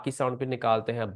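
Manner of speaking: ordinary talk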